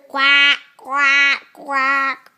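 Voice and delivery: loud quacking, duck noise. Shown as a demonstration.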